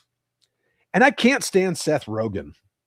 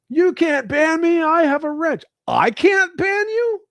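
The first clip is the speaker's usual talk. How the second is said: high, slow mock challenge; mock defiance; 14 semitones higher, 5 dB louder than usual. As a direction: ham up the chatter's dare, high and slow. Mock quote then mock disbelief.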